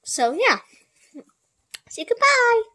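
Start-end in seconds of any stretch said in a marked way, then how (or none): 1.9-2.6 high pet-talk voice. Real, not acted. Coaxing horse to say goodbye.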